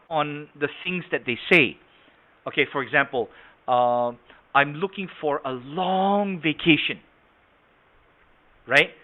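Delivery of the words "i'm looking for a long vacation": "long" drawn way out; inflection on long. Shown as a demonstration. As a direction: stretch "long" slowly, then finish the phrase. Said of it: "that's the inflection of the word".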